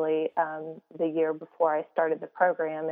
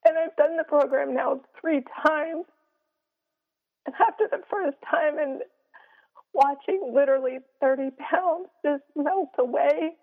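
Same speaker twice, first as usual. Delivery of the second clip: high, tight voice after crying; teary; 8 semitones higher than usual. Real, not acted. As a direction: speak on through held-back tears. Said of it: "sorry, I'm getting a little teary".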